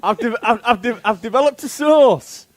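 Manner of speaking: put-on a proud caveman with a Northern accent. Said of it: "With the accent".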